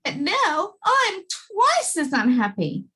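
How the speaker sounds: wailing king. Acted. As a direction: drag out the misery, wailing. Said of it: "he wailed".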